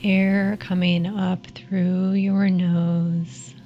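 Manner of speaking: calm them down gently, unhurried. Acted. Leads a guided meditation.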